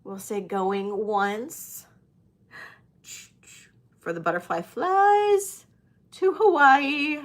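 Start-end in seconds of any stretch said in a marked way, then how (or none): 0.4-1.9 slow, drawn-out going once; slow count. Shown as a demonstration. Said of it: "I'll go a little slower". 4.0-7.2 high, bright playful sing-song. Pretend.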